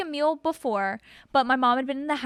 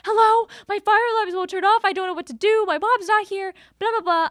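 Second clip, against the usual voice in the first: much higher, louder distressed rush; frantic call; 7 semitones higher, 4 dB louder than usual. Retold or imitated. Retelling her call to emergency maintenance, in a frantic higher voice. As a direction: sound close to tears on the phone.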